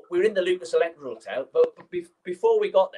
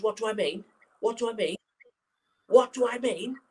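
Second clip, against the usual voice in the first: imitated manager losing it, rising each time; building mock rage; 7 semitones higher than usual. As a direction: work yourself up into a fury. Angry manager repeating, building.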